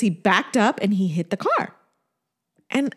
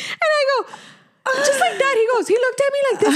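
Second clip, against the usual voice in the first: high, words broken by laughter; laughing while talking; 13 semitones higher than usual. Real, not acted. Telling her friend the story, laughing hard. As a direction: talk through tears of laughter.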